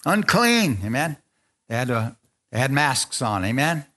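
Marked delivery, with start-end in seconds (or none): 0.0-0.8 mock leper call out; leper's cry. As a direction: call out a warning, raised high. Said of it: "cover their face, say".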